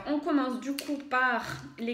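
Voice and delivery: usual speaking voice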